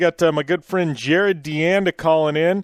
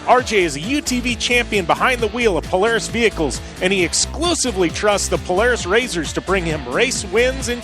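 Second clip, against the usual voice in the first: ad read; 3 semitones higher than usual. Acted. Reads a sponsor ad.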